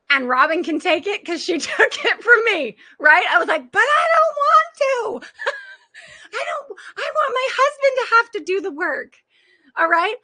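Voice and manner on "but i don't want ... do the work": put-on much higher, whiny crying yell like a child; mock tantrum. Coach reliving her own tantrum over having to change, whiny crying yell. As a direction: wail it out like a kid refusing to do chores. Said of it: "once you can have your tantrum".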